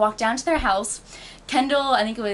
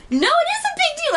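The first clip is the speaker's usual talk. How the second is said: very high, loud indignant protest; heated argument, pitch shooting up; getting louder; 5 dB louder than usual. Retold or imitated. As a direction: burst out in retold outrage. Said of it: "getting louder".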